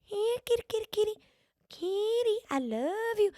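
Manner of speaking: very high, soft cooing meow and kitty-call; high, hushed sing-song to win a cat over. Shown as a demonstration. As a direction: lure the grouchy cat with a sweet little high voice. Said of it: "I start doing my little meow".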